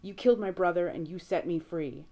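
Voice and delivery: angry. A narrator's reading. Angry at demon after death.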